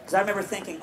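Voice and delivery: ordinary talk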